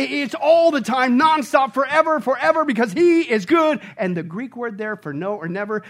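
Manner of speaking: ordinary delivery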